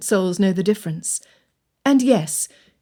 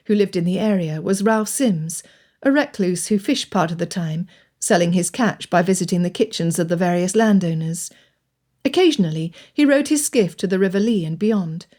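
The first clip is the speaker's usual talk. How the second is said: ordinary delivery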